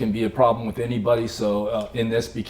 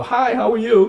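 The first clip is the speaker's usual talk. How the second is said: cheery greeting; 12 semitones higher, 6 dB louder than usual. Retold or imitated. Acting his own cheery greeting.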